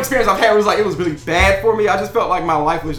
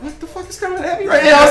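shocked laughing; 9 semitones higher than usual. Retold or imitated. Retelling his shock, laughing.

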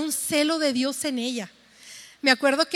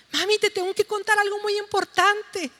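put-on excited child; 6 semitones higher than usual. Daughter excited to tell mother.

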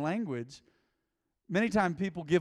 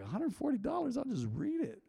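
high, soft, balking at a price; mock incredulity; 7 semitones higher, 6 dB quieter than usual. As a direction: recoil lightly from the cost.

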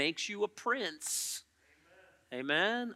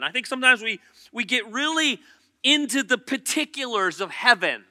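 loud, higher preaching, building; full-force preaching; 5 semitones higher, 12 dB louder than usual. Acted. Preacher telling the church they will be like Christ, loud and rising. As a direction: preach the hope of his return.

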